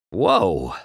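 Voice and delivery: ordinary delivery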